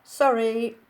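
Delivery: put-on high, loud piping Capitol accent; silly Capitol accent. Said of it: "she pipes in her silly capital accent".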